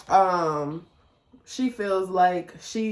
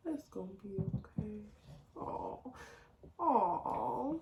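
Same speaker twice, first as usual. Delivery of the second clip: very soft, tiny cooing voice; imitating cute daughter; 3 semitones higher, 13 dB quieter, slower than usual. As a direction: comfort softly like a small child. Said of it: "Noelle just so small and cute".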